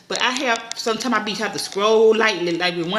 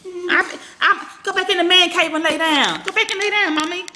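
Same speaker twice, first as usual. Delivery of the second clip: laughing shoo; 9 semitones higher than usual. Real, not acted. Mother laughing as she shoos her toddler back to bed.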